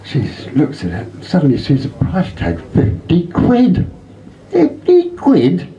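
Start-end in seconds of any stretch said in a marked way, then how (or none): 2.7-3.9 high, slow disbelieving rise; drawn-out high amazement at the price; surprised. Acted. Woman reads the parrot's price tag, high amazed drawl. 4.5-5.7 very high, slow stunned question; slow squeal of disbelief; incredulous. Acted. Woman repeats the parrot's price, very high stunned question.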